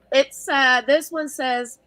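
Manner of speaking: usual speaking voice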